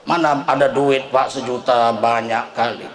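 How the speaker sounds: low, slow grumbling congregant voice; a lightly voiced stingy villager; mock complaint. Preacher mimics a villager grumbling about the cost, low and slow.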